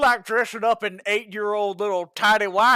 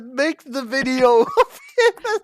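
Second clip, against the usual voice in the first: words dissolving into high laughter; laughing; 10 semitones higher than usual. Real, not acted. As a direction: lose it in laughter. Laughing hard while talking.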